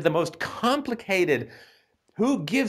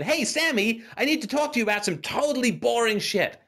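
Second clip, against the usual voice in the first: mock pompous caller, higher; playing the officious neighbour from Porlock; pompous officious visitor; 7 semitones higher than usual. The nosy visitor from Porlock interrupting the poet, mock pompous. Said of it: "pompous, officious little bastard from Porlock".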